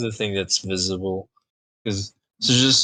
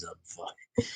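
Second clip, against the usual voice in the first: muttered insult; 12 dB quieter than usual. Acted. Insulting aside under breath.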